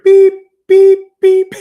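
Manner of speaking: high, loud imitated horn beeps; ship horn beeps. Telling viewers how the ship backed out, loud mock horn beeps. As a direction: honk it out like a big ship's horn.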